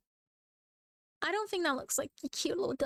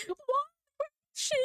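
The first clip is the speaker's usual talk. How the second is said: confused viewers; faster than usual. Retold or imitated. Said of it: "people were like".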